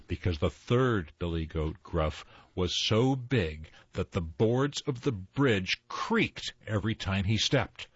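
usual speaking voice

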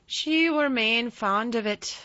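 put-on slow fond old gardener; Yorkshire old man. A narrator's reading. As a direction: play a wistful old Yorkshireman.

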